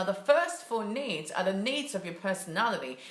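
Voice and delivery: ordinary talk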